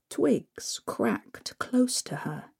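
ordinary delivery

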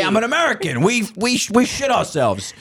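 hammed-up flag-waving boast; mocking Americans proudly refusing bidets.

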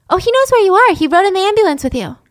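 high, bright sing-song nurse; cheerful nurse. Retold or imitated. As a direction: chirp it like a clueless nurse.

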